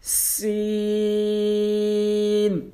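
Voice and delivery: سین drawn out like a whistle; whistling, long سین; stretched Seen. Shown as a demonstration. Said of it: "کھینچ کے پڑھنا ہے".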